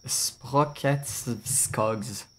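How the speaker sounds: "Sprocketskogs" drawn out very slowly; attempting a hard name. Real, not acted. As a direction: sound out "Sprocketskogs" piece by piece. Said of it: "let me try to, to say that".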